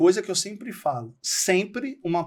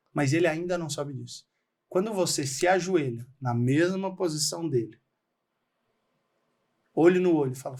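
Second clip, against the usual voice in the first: usual speaking voice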